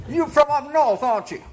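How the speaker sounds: put-on high, loud Kentucky country accent; Southern US accent. Showing Russian listeners how Southerners talk, hammed-up Southern accent. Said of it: "in the South, people talk like this".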